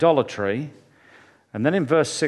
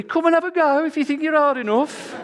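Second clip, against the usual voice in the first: high Birmingham football chant; Birmingham accent; 11 semitones higher than usual. Retold or imitated. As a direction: taunt the rival fans in a Birmingham accent, pitched high. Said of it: "in my great Birmingham accent".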